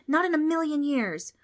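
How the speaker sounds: high, defiant Trix; defiant girl. Acted. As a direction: stand up to the ghost. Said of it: "with all the courage".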